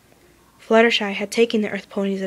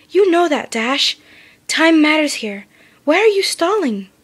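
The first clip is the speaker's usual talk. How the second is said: urgent insistence; 5 semitones higher, 5 dB louder than usual. A narrator's reading. Twilight presses urgently.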